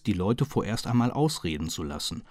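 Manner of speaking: plain reading voice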